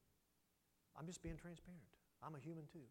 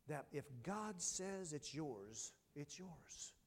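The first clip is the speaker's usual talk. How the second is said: slow deliberate emphasis; much slower than usual. Acted. Preacher stressing the promise slowly. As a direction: stretch out if God says it slowly.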